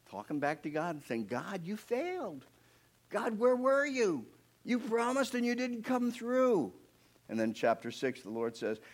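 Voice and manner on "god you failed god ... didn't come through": much higher, slow, wounded reproach; slow anguished accusation, pitched up; accusing Moses. Acted. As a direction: cry out that God let him down. Moses talking back to God.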